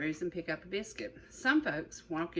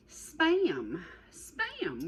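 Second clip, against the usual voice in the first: excited reveal; 4 semitones higher than usual. Real, not acted. Reveal of biscuit filling.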